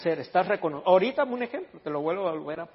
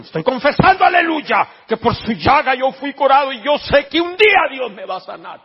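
high, hammed-up revival shouting; mock fervent shout; 10 semitones higher, 12 dB louder than usual. Believer asked what they are doing, mock fervent shouting. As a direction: shout your confession with exaggerated zeal. Mocking dead-works faith confessor.

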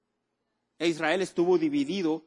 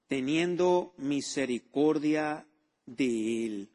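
drawn-out emphasis; much slower than usual. Acted. Preacher slows on key phrase.

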